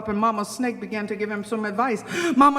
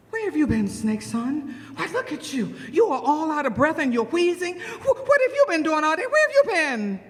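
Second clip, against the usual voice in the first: flustered questions, climbing higher; fussing mother snake; 5 semitones higher than usual. Acted. Mama Snake questions her breathless son, fussing. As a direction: scold with rising alarm.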